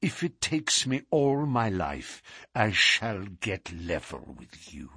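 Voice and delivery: soft, deliberate vow sinking in pitch; measured, quiet menace; slow deliberation. A narrator's reading. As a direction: let the threat settle down quietly. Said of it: "speaking with slow deliberation".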